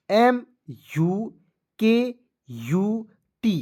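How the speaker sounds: slow, separated letters; one drawn-out letter at a time; letter-by-letter spelling. Shown as a demonstration. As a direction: spell it out slowly with gaps between letters. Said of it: "देखिए मेरे साथ बोलिएगा".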